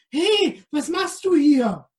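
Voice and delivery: high, loud startled call. Acted. Helene calls out loud at the intruder. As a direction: shout it out, caught off guard. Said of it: "fragte sie laut".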